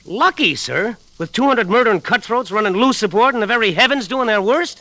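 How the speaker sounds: mate confronting the stubborn captain; indignant outburst. Acted. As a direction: let the frustration burst out.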